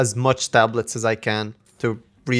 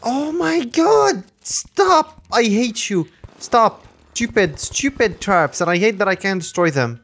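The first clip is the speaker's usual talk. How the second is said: high, drawn-out wail easing down; exasperated outcry; 9 semitones higher, slower than usual. Real, not acted. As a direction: whine in protest at the traps. Exasperated at traps.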